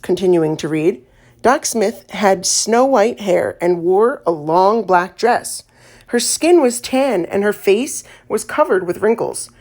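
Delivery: ordinary delivery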